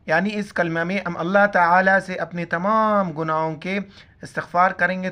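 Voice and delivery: ordinary talk, nothing marked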